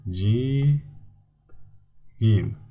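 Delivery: "Ji" long and rising, pause, "gim"; syllable by syllable. Shown as a demonstration. Teacher gives students the answer, "Ji, gim" in separate syllables. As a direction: break it into "Ji" and "gim". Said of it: "I'm going to do it for you".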